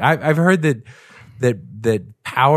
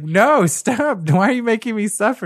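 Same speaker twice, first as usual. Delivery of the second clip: high, fast begging whine; whiny mock plea; 11 semitones higher than usual. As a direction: beg like a hammed-up victim. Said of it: "And he's like".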